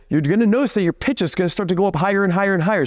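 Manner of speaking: hurried demo of nervous climbing pitch; fast, rising. Shown as a demonstration. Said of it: "if you keep talking like this".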